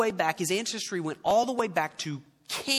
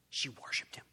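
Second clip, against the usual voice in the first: soft, near-whispered statement; hushed reverence; 6 semitones lower, 11 dB quieter than usual. Real, not acted. Preacher telling what the woman did, hushed. Hushed answer to own question.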